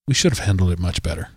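low, quick official statement voice; mock the police spokesman's dry apology; quoting official. Spokesman quoted to the newspaper, low and quick.

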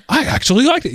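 imitating an astonished listener; high, incredulous approval; surprised. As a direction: say it amazed that it was any good. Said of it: "with so much surprise in your voice".

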